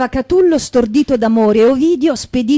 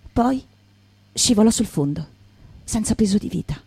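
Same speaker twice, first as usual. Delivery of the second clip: solemn narration; 2 semitones lower, 6 dB quieter than usual. A narrator's reading. Narrator closes the fallen man's story with a proverb, solemn narration.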